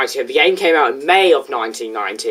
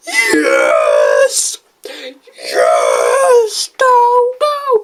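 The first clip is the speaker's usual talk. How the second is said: terrible impression of a game villain's cry; drawn-out, hammed-up villain shout; M. Bison; much slower than usual. Shown as a demonstration. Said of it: "that was a terrible impression".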